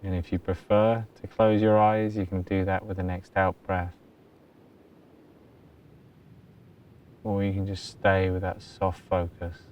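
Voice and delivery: very soft, low-key meditation voice with long pauses; hushed, even delivery, letting each phrase settle; guided meditation. Acted. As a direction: guide them gently into stillness. Leads a meditation.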